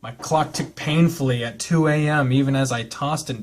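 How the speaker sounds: calm, even read-aloud narration; story narration. Acted. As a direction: read the novel text evenly, like an audiobook. Reads visual novel narration.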